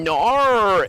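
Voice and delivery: "nar," with a drawn-out r-coloured vowel for "no"; mock "no" as a long "nar,"; exaggerated accent mimicry. Streamer mimicking how others say no, exaggerated "nar,". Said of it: "when I hear them saying".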